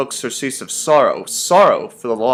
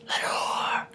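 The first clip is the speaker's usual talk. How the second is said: ghostly breath of a whisper; hushed, drawn-out whispered echo; murmured echo; 13 dB quieter than usual. A narrator's reading. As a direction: whisper it back like a faint echo. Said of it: "an echo murmured back the word".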